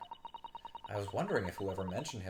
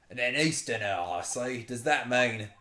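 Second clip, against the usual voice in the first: put-on Scottish governor; Scottish accent; 4 dB louder than usual. Governor sizing up an Eastern visitor, Scottish accent. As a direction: question the visitor in mock Scots. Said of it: "he's very Scottish".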